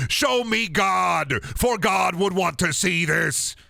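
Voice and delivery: slow, high, drawn-out preacher cry; grand proclamation. Acted. Streamer declaiming to chat like a preacher. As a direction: call out to God in mock fervour.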